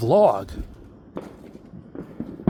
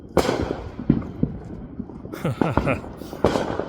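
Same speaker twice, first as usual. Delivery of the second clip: breathy giggle; 2 semitones higher than usual. Real, not acted. Laughing after the silly pirate bit.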